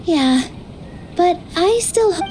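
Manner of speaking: usual speaking voice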